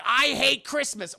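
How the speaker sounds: high, loud, drawn-out comic outburst; mock angry shout. Woman objecting to a Christmas tree, mock angry shout. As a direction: belt it out as hammed-up hatred.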